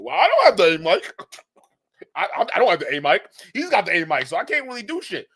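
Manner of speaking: mocking imitation of a lame excuse, pitch rising at the end; hammed-up whiny excuse. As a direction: put on a whiny, self-pitying excuse voice.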